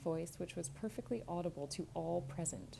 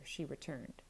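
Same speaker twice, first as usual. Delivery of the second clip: plain reading voice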